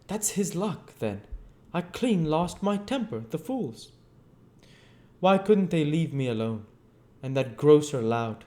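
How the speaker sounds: lightly voiced temper; resentful Invisible Man. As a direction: snap out the grievance. Lost my temper.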